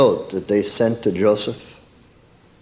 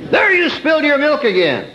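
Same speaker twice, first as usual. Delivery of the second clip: mock tirade. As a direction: scold the child for spilled milk in a shrill burst. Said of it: "braced for a tirade".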